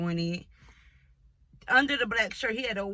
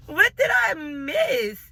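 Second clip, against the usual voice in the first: higher and louder, slow, laughing through it; laughing disbelief; 9 semitones higher, 9 dB louder, slower than usual. Retold or imitated. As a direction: say it slowly while cracking up. Shocked at his ugly face.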